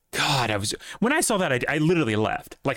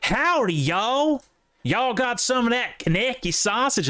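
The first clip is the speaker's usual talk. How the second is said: high, hammed-up Southern "howdy" drawl; Southern accent; 11 semitones higher than usual. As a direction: put on an exaggerated Southern accent, pitched way up. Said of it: "go up there and say".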